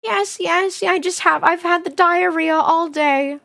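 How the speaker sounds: put-on high, wobbly old grandma voice; imitating grandma. Grandma on the phone explaining her stomach, high granny voice. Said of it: "She's like".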